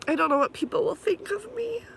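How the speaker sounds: high, cracking with emotion; voice breaking. Real, not acted. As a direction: push through the tears.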